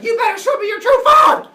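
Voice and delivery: very high, very loud laughing scream; furious scream. Acted. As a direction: scream the demand at full blast. Semele screams final demand.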